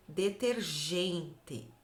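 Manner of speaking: slow word. Shown as a demonstration. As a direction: draw out "detergente" slowly. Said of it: "o som é G".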